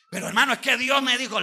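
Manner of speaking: insistent protest. Retold or imitated. Insistent tither argues with preacher.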